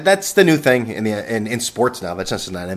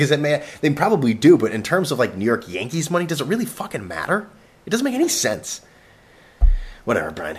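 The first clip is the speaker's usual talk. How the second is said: usual speaking voice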